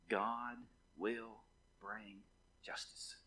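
low, soft exhortation in short, paused phrases; hushed preaching. Real, not acted. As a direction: solemnly urge them, letting each phrase settle.

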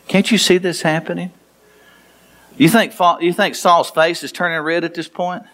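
ordinary talk, nothing marked

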